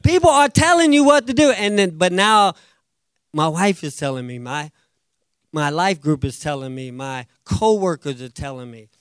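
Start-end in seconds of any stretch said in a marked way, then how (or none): none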